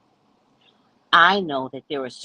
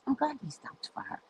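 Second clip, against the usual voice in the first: soft, raised-pitch needling; retold sing-song dig; teasing; 7 semitones higher, 12 dB quieter than usual. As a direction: tease her slyly and quietly. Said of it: "I'm teasing her now".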